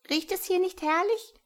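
very high squeaky mouse. A narrator's reading. The mouse pulling Hjalmar, in a very high squeak. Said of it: "fragte die Maus".

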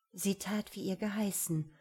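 ordinary delivery, nothing marked

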